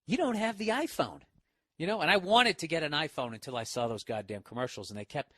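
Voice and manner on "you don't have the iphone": mocking the ad. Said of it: "that really cunty commercial where they go".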